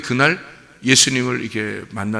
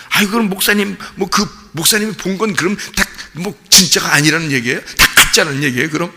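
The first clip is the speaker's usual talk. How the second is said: higher, sing-song protesting voice; mock objecting congregant; 5 semitones higher, 4 dB louder than usual. Pastor voicing a doubting congregant, mock protesting tone. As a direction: voice a doubting listener pushing back.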